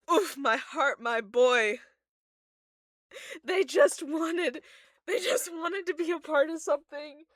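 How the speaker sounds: loud, high heartbroken fan lament; mock anguish. Fan mourning a favourite character, mock anguish. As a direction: wail dramatically over your poor boy.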